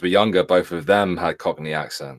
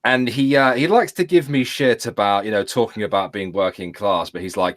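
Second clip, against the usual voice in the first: usual speaking voice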